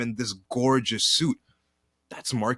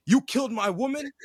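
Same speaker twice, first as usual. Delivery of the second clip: much higher, hammed-up outrage at a killer; mock vengeful rant; 7 semitones higher than usual. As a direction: rant like a wronged villain swearing revenge. Voicing Quaritch's revenge rant.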